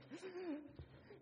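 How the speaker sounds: sharp, very high mock gasp of fright; high imitated gasp of terror; terrified gasp. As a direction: gasp like a scared little boy. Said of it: "We'd be like".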